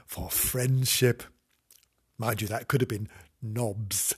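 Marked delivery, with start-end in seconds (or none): none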